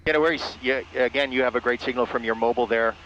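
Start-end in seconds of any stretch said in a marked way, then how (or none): none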